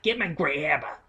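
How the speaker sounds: put-on soft creepy old woman voice; imitating the creepy witch lady; witchy old lady. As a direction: rasp it like the Hansel and Gretel witch. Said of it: "our old ladies sound almost exactly alike".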